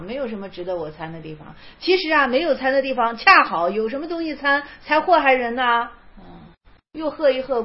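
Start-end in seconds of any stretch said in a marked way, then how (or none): 0.0-1.5 quiet echo. Shown as a demonstration. Quiet echo of same line.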